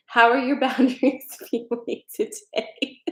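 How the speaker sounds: giggling through the question, pitch up; can't keep a straight face asking it; laughing mid-question. Real, not acted. Repeating the question, cracking up.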